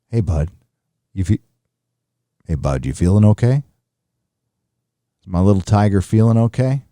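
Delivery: put-on low, gentle caring dad checking on his sick kid; best dad voice. Chat asked for a dad voice to a sick kid, lightly low caring. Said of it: "Use best dad voice".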